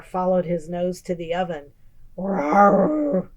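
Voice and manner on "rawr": bear rawr. A narrator's reading. Bear growls at oven.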